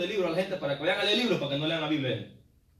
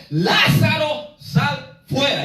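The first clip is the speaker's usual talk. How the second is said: slow, loud, high shout of a name; Jesus shouting into the tomb, a slow commanding cry; loud call; 4 semitones higher, 6 dB louder, much slower than usual. Retold or imitated. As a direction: call him out of the tomb with full voice. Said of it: "alzó la voz y le gritó".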